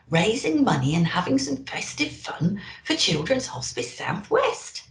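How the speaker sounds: ordinary delivery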